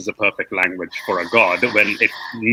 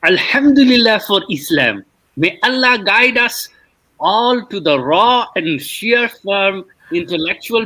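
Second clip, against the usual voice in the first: mock pious voice; 8 semitones higher than usual. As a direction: read it like a starry-eyed believer. Reading a pious comment mockingly.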